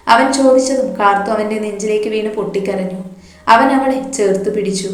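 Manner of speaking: usual speaking voice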